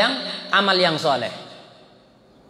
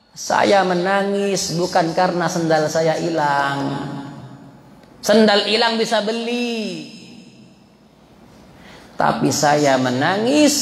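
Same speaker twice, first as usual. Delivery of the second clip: grieving imam; 5 semitones lower than usual. Retold or imitated. Voices the grieving imam explaining.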